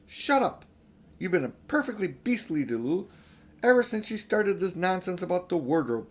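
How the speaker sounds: high, loud and cutting; angry scolding. A narrator's reading. Peter scolding his younger brother, angry and high. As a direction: tear into him. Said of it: "turning on him savagely".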